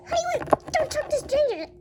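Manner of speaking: high, quick warning call; alarmed parent. Pretend. Parent stopping child from talking to strangers, alarmed.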